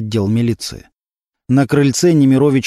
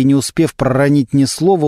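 plain reading voice

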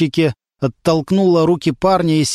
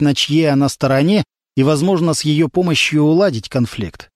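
ordinary delivery

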